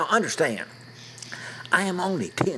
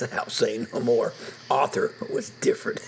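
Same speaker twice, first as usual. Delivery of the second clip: usual speaking voice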